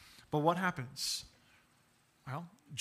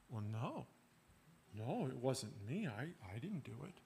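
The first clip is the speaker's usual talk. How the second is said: lower, much softer, sheepish no; child denying; 5 semitones lower, 13 dB quieter than usual. Retold or imitated. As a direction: mumble a guilty denial like a child. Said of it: "expect someone to say no".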